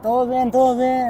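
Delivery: it's all fine, come on; reassuring mom. Retold or imitated. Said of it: "And she's like".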